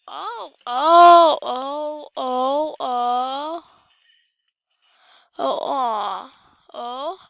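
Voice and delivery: loud, slow wailing groans; anguished wail. Real, not acted. Gamer wailing after breaking something. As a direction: moan in loud dismay.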